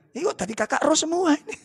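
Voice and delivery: light Malaysian cartoon accent, teasing; playful Malaysian accent; Malaysian accent like Upin Ipin. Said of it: "kayak Upin Ipin nek ngomongnya".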